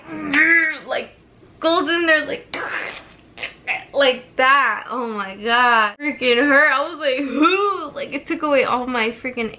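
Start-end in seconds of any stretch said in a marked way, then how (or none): 0.1-0.9 straining growl. Retold or imitated. Imitating surgeon pulling hard. 7.2-7.9 breathless gasp. Retold or imitated. Air knocked out of her.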